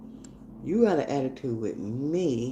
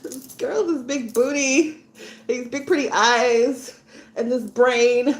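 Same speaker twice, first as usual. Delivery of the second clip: put-on higher, whining teenage girl; upset daughter; 8 semitones higher than usual. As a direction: plead with mom, upset. Teen daughter pleading to mom.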